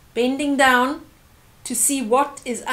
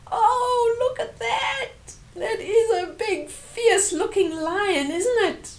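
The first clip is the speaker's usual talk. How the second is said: much higher squeaky scared voice; mock scared; 11 semitones higher than usual. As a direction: play up the fright for the kids. Reader mimics scared voice.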